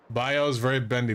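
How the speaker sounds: usual speaking voice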